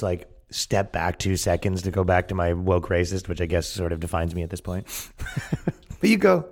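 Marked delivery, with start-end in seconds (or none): 4.9-6.0 breathy laugh. Real, not acted. Laughing alone.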